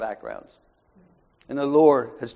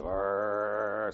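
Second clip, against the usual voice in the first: low rumbling growl like a wary dog; a dog's warning growl from a truck; imitated dog growl; 6 semitones lower, 8 dB quieter than usual. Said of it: "He said".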